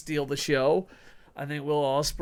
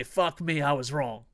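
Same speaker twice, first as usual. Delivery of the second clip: normal talking voice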